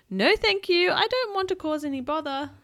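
put-on high, polite storybook girl; well-mannered girl, pitched high; polite refusal. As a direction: decline courteously in a lifted voice. Polite girl declines horse's offer.

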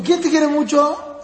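slow, playful coaxing with a lifted pitch; playful teasing. Retold or imitated. Father asks his small daughter in jest, playful coaxing. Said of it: "Yo siempre digo de vacilado".

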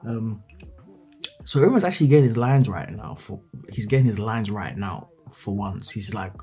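ordinary talk, nothing marked

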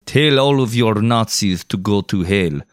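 put-on slow, deliberate Russian accent; unhurried mock Russian soldier's accent. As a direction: give it a heavy Russian accent, word by word. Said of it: "Can you do a Russian accent?".

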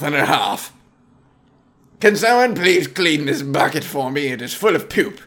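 stalk off like an offended gentleman; hammed-up aristocratic exit; huffy snob. Snob storms off.

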